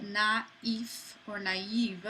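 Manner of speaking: "naif" and "naive" slowly, each in two syllables; separated vowels. Shown as a demonstration. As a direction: draw out "nah-EEF" and "nah-EEV". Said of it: "you pronounce these separately".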